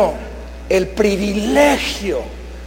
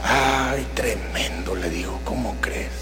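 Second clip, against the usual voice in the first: soft, whispered, much lower; hushed fatherly whisper; 10 semitones lower, 9 dB quieter than usual. Retold or imitated. Father quietly asks his son on the phone. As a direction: gently ask the son what happened.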